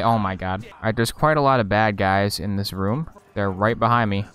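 ordinary talk

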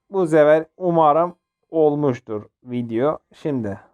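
ordinary delivery

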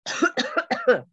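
loud, high choking cough; guard chokes on filtered air; gagging cough. Acted. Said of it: "gulp of filtered air and gagged on it".

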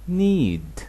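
need drawn out very slowly; slowed down. Shown as a demonstration. Teacher slowing a word so students can copy it. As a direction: stretch need for the learners. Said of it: "ببطئها عشان تعرف تنطقها".

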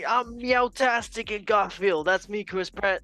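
hammed-up Chris Pratt for the intro; Chris Pratt impression. Podcast host opens the show as Chris Pratt, hammed-up impression. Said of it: "I can't keep up with his voice".

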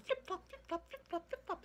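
high sing-song flip flop; playful high-pitched tail sound; squeaky flip flop. Acted. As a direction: bounce the sound up high and light. Squirrel's tail sound effect.

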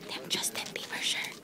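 hushed whisper, barely voiced. Real, not acted. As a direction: whisper it so nobody nearby hears. Whispering in crowded store.

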